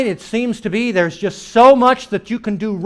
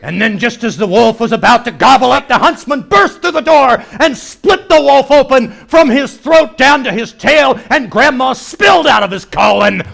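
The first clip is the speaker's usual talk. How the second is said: loud, higher, building tale-teller; dramatic storytelling; 4 semitones higher, 5 dB louder than usual. Pretend. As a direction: tell the gory climax with full drama. Dramatic fairy tale telling.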